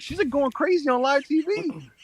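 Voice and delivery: slow, high-pitched astonishment; retold disbelief. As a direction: act out your stunned reaction, stretching the words. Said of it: "I was like".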